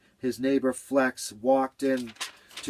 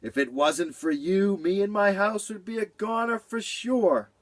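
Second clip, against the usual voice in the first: grateful; 9 semitones higher than usual. Acted. Beaver thanks Cat.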